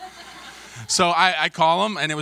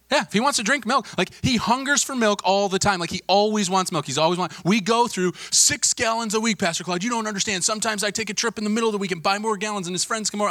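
higher-pitched parent gushing on and on; imitated insistent parent; 8 semitones higher than usual. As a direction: insist it like an exasperated mom or dad. Parent insisting in retold call.

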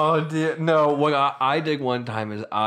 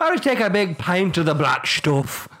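raised, hammed-up Irish brogue; Irish accent; 5 semitones higher than usual. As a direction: order like a comic Irishman. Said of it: "your Irish thing is killing me".

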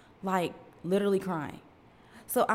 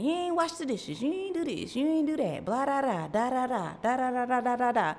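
mock rant; 5 semitones higher than usual. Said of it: "I was spazzing".

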